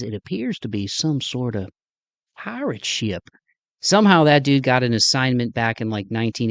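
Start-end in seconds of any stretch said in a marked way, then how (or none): 0.0-3.2 lightly voiced Southern newsman; Southern reporter. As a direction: quote the Goonies reporter with a hint of a Southern accent. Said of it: "and he says".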